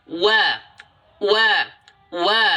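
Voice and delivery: wa with lips pushed forward; rounded waw. Shown as a demonstration. Teacher showing proper waw, lips rounded. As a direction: push the lips out for each wa. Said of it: "Wau itu seperti apa".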